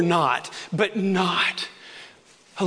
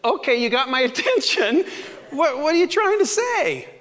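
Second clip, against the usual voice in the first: amused surrender; 7 semitones higher than usual. Retold or imitated. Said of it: "I said".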